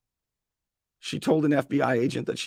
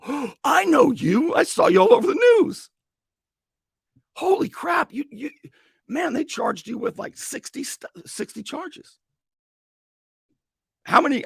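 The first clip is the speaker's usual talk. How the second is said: very high, loud, gushing excitement; starstruck stranger; 13 semitones higher, 5 dB louder than usual. Retold or imitated. Stranger recognizing the ex-mayor from the news, excited and shrill. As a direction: squeal like you've spotted a celebrity.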